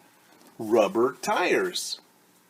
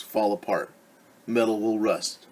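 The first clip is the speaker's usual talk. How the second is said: plain reading voice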